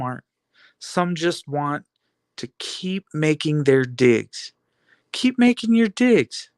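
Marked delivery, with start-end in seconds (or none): 5.1-6.5 taunting. Acted. Taunting the haters.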